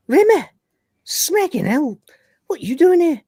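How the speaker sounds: high, startled yelp of disbelief; shocked Lister. Retold or imitated. Lister recoiling from Rimmer's sudden return with a high, startled yelp. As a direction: squeal it out in shock.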